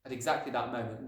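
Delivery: ordinary delivery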